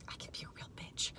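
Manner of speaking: soft unvoiced confession; whispered aside. Real, not acted. Telling viewers she can handle men, whispered. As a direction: let it slip in a whisper.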